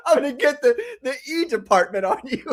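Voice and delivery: amused and dismissive, breaking into laughter; laughing mock threat. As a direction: laugh through a pretend threat. Mocking an official threat while laughing.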